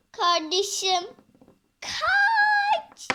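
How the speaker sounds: high, loud crying warning; a hammed-up terrified cry; panicked cry. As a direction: cry out for them to run. Warning sibling to flee.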